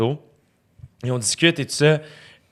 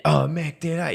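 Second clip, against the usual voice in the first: higher-pitched imitation of a kindly French director; Ramsey; 4 semitones higher, slower than usual. Director greeting his actor on set, gushing imitation. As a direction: greet him like a delighted French mec. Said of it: "Ramsey est juste là".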